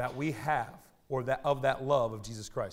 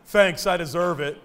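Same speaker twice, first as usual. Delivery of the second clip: put-on sarcastic kid; 3 semitones higher, 9 dB louder than usual.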